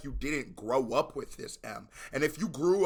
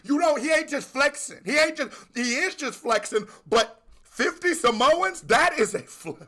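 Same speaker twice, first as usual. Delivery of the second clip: loud, high, thrilled yelling about the bar; hype shout; 12 semitones higher, 8 dB louder than usual. Real, not acted. Reacting to the song, shouting with excitement. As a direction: explode with excitement at the lyric.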